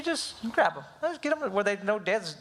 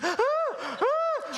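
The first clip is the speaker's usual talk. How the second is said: high, airless mock gasping; imitated breathless gasps. Dad imitates his son unable to breathe, high gasps. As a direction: gasp like a crying kid who can't catch air. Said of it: "he can't get no air".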